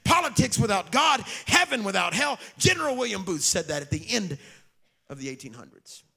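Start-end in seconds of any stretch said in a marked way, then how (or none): 0.0-2.4 loud, ringing preacher cadence over music; preaching at full force. Acted. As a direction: hammer each line to the crowd. Preaching climax list.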